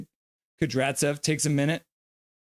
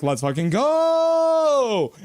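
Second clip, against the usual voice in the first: drawn-out hype yell; 9 semitones higher, 4 dB louder, much slower than usual. Retold or imitated. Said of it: "the line change is like".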